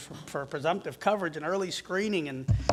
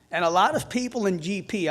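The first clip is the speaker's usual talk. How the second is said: ordinary talk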